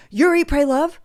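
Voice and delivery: high, loud, thrilled double take; excited disbelief. Retold or imitated. Immigration officer recognizing the famous author, excited. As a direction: squeal it like you've met a celebrity. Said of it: "And she goes".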